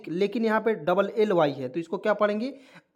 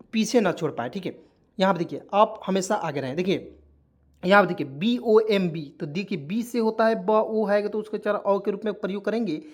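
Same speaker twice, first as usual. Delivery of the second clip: ordinary delivery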